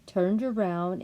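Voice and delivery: usual speaking voice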